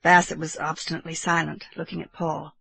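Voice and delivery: ordinary delivery, nothing marked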